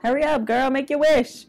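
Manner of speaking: yelling at the TV to hurry; urging. Real, not acted. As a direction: push her to hurry up. Urging the character on screen.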